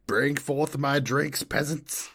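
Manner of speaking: hammed-up monarch ordering servants; mock royal command. From her window-sill throne to her staff, slow haughty mock decree.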